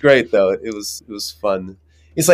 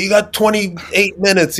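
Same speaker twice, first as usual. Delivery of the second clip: much higher and slower, drawn-out countdown; mocking wrangler; 9 semitones higher, slower than usual. Wrangler nagging him about lunch time, high and drawn out. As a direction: needle him with the time left.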